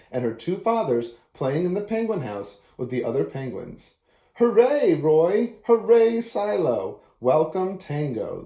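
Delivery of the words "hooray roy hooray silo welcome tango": loud bright cheer on each hooray. Acted. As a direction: cheer like children greeting the penguins. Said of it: "they cheered".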